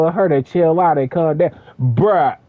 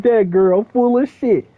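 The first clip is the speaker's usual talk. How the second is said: quoting mom; 6 semitones higher than usual. Retold or imitated. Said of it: "She used to be like".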